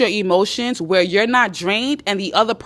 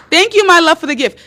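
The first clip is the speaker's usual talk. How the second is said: raised, theatrical thanks; mock gratitude; 8 semitones higher, 5 dB louder than usual. Woman applauding her man's act, mock grateful. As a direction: start big and high, as if accepting a present.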